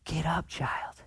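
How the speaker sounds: soft, hushed and gentle; Jesus tenderly waking the little girl; tender whisper. Retold or imitated. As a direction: whisper it lovingly to wake a child. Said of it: "he looks at her and uses this phrase".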